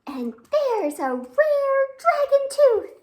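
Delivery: high, loud and slow with wonder; awed excitement. A narrator's reading. Storyteller reveals the rare dragon tooth, awed and excited. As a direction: unveil a treasure to the kids. Reading wonder-filled picture book.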